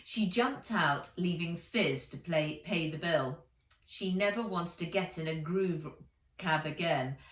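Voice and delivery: ordinary delivery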